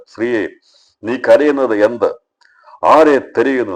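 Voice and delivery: high, loud voice of Jesus questioning; ഉച്ചത്തിലുള്ള ചോദ്യം. Acted. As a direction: call out the question to her loudly. Jesus questions weeping Mary. (ഉയർന്ന ഉച്ചത്തിലുള്ള യേശുവിന്റെ ചോദ്യം)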